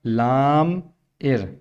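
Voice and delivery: लाम and इर held apart slowly; slow syllable split for a pupil. Shown as a demonstration. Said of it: "I R को side किया".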